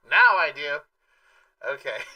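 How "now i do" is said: put-on old radio show. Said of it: "Do I sound like I'm on old-time radio?".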